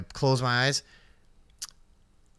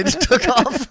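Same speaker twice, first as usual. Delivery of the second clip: high laughing finish; 13 semitones higher, 5 dB louder, slower than usual. Real, not acted. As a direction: laugh through the words. Laughing at end of story.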